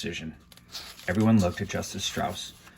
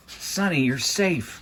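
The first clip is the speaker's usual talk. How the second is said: higher, eager call to his sister; relieved cry; 6 semitones higher, much slower than usual. A narrator's reading. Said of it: "Klaus cried".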